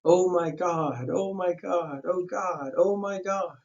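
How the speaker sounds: high, slow moaning cry; high wailing call. A narrator's reading. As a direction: wail it over and over. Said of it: "his high, horrible call".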